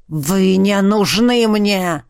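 slow, menacing dragon growl. A narrator's reading. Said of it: "прорычал чёрный дракон".

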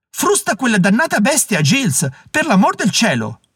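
high-pitched, urgent passenger; acted panic, voice pitched well up; panicked order. A narrator's reading. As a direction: cry out to the driver in alarm. Panicked passenger orders driver to whip.

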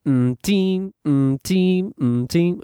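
put-on "mm, teen": hummed blank, then TEEN held out; final syllable shown. Shown as a demonstration. Teacher shows the shared final syllable, "teen" drawn out after a hum. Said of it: "you say".

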